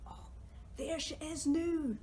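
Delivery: ordinary delivery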